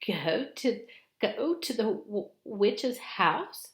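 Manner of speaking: high, loud, aghast stammer; horrified at the very idea; alarmed Missus Beaver. Acted. Missus Beaver aghast at the children's plan, loud and high.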